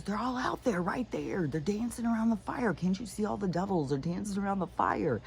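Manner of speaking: high, frantic, insisting the devils are there; frightened husband, high. Retold or imitated. Husband telling the cop the devils dance there, high and frantic. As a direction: voice jumps up high, pleading and scared. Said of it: "And he goes".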